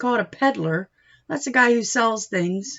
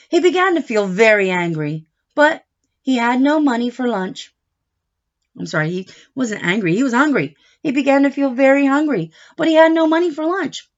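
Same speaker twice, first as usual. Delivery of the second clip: bright, louder storybook narration; lively read-aloud; 3 semitones higher, 7 dB louder than usual. Acted. Teacher reading a picture book to a child, animated. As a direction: read it big for a little listener.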